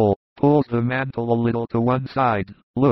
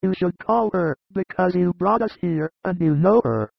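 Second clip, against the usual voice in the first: high, insistent voices; pressing shepherds; 7 semitones higher than usual. A narrator's reading. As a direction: urge him in raised pitch.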